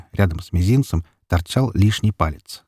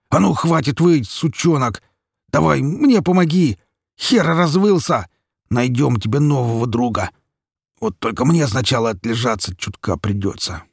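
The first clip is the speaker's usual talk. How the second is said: raised, growling orders easing off at the end; pained growl; 5 semitones higher than usual. A narrator's reading. Wounded Mikola ordering his howling son, growling then easing off. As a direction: bark impatiently, then settle down. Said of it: "прорычал Микола".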